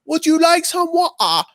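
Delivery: squeaky-high mock British accent; hammed-up, very high fake British; bad British accent. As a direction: imitate someone badly attempting British. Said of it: "trying to do a British accent".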